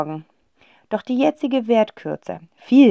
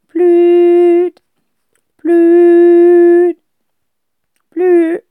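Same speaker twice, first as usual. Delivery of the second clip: high, loud phone tone; phone ringing tone; 11 semitones higher, 11 dB louder, much slower than usual. A narrator's reading. Dial tone from the phone on speaker, beeping. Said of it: "tönt es".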